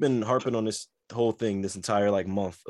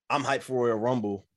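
ordinary talk, nothing marked

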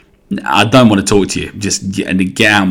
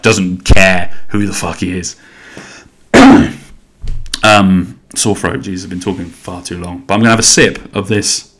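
ordinary talk, nothing marked

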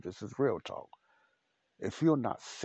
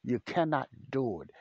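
ordinary talk

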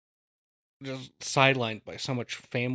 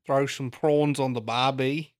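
slow mock Australian, a bit louder; lightly put-on Aussie drawl for "prawns on the barbie"; Australian accent; 5 dB louder, slower than usual. Riffing on a friend speaking Australian, mock Aussie accent.

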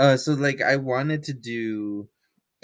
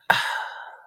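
breathy ASMR sigh. Shown as a demonstration. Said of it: "it would probably be like this".

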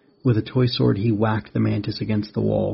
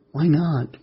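put-on higher-pitched boyish plea; pleading; 6 semitones higher than usual. A narrator's reading. Rex questioning the spider's refusal, high pleading. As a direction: plead in a raised young voice. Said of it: "Rex pleaded".

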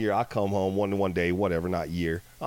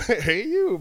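mock reply; 13 semitones higher, 6 dB louder than usual. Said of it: "You know?".

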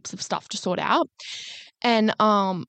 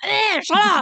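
high, scoffing shut up; mock dismissive; 9 semitones higher, slower than usual. Friend brushing off friend's complaint, playful scoff.

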